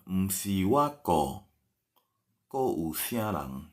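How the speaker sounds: very slow, each word stretched; slow model sentence. Shown as a demonstration. Teacher translates a sentence into Hokkien, very slowly.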